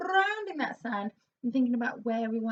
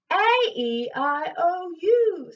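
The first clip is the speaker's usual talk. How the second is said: raised palate vowels; 6 semitones higher, 11 dB louder, much slower than usual. Shown as a demonstration. Coach leading class with a raised palate. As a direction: lift the roof of the mouth and project. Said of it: "nice high raised roof of the mouth".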